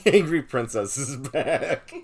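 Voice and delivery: spoken through laughter, slow and loud; laughing. Real, not acted. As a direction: chuckle out the line. Laughing at mom's text.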